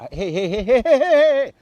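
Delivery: rapid "heys" rising high and loud; calling out. Acted. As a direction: cut in to halt runaway thoughts. Demonstrates halting anxious thoughts by calling out.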